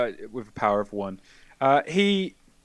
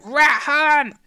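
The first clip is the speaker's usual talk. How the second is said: put-on much higher, much louder weedy kid screaming "Rat hunt!"; a scrawny kid yelling "Rat hunt!" from outside in a thin, high shriek; 11 dB louder than usual. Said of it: "like, weedy high voice".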